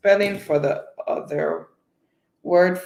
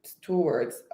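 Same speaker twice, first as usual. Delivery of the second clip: usual speaking voice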